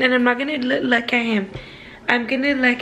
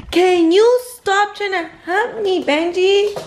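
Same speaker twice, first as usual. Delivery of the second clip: annoyed scolding; 7 semitones higher, 4 dB louder, slower than usual. Real, not acted. Dog humping her mid-video.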